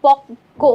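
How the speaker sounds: পক্ক said pok-ko with the k held double; doubled k. Shown as a demonstration. Teacher showing students the doubled k in পক্ক. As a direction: stress the doubled k in পক্ক clearly. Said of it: "ককে আমাদের দুইবার উচ্চারণ করতে হয়েছে".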